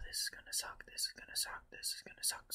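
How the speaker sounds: dread-filled whisper. Real, not acted.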